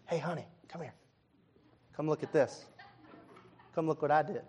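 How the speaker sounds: soft, hushed, proud husband with a little laugh; mock-proud murmur, showing off the clean dishes. Husband calls his wife to admire the dishes, soft and proud.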